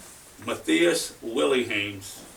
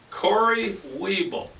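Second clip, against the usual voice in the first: slow, higher, stretched winner's name; drawn-out announcer call; 5 semitones higher, slower than usual. Real, not acted. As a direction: roll the name out like a game-show host.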